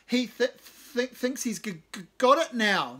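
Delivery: high, loud mock stammer; loud mocking imitation of a stammer; mocking yelp. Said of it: "Nick Cave yelps".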